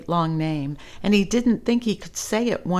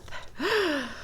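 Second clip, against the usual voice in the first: big gasping breath in; deep gasp; 10 semitones higher, 7 dB quieter than usual. Acted. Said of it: "took a deep breath".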